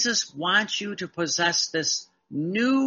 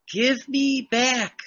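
voicing Jesus; 7 semitones higher, slower than usual. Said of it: "Jesus says".

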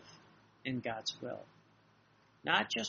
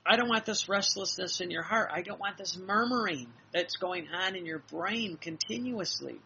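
louder, higher insistent rebuke; emphatic admonishing; 4 semitones higher, 3 dB louder than usual. Retold or imitated. Jesus rebuking the restless heart, emphatic admonishing. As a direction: lay down the law, raised and forceful. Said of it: "He says".

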